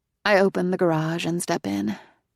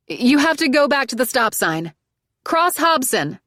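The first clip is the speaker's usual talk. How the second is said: raised, higher voice over the rain; saying louder; 8 semitones higher, 6 dB louder than usual. Acted. Narrator repeats directions to driver, louder and higher. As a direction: call the directions out so she hears. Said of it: "I say louder now".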